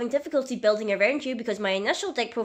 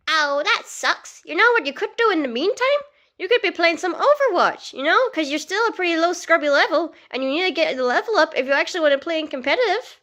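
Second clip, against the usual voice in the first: much higher, louder, pushy put-on Tracer; 7 semitones higher, 6 dB louder than usual. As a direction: nag her to play Overwatch like an eager Tracer. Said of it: "Tracer, I can't play Overwatch right now".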